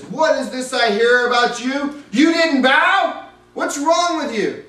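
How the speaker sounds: high, loud, slow outraged demands; king berating the three men, furious and loud. Acted. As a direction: rage at the disobedient servants. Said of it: "Nebuchadnezzar was furious".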